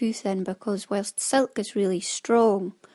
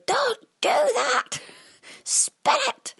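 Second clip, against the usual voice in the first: much higher, slow, horrified cry; alarmed expert knitters; slower than usual. Retold or imitated. Experienced spinners crying out at the listener's shortcut, horrified. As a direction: squeal it like a shocked expert. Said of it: "are going".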